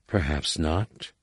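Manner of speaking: soft and slow, barely above a breath; hushed and unhurried; quiet. A narrator's reading. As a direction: let it fall gently, almost to nothing. Vergere answers Jacen quietly.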